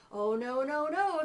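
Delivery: fly's refusal. Acted. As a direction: refuse the spider firmly. Fly refuses.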